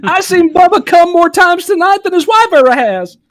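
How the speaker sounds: squeaky high laughing shout; high falsetto joke. Acted.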